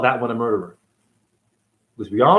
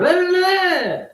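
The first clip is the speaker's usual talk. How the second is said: mocking sing-song; much slower than usual. Mocking those who ignore him.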